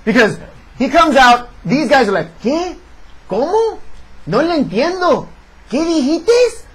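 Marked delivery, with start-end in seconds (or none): 2.4-6.6 a confused extra in hammed-up American Spanish, pitched up; bad Spanish accent. Mocking the wrestling extras' bad Spanish accent. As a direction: play the lost extra, mock Spanish accent. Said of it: "These guys have terrible Spanish accents".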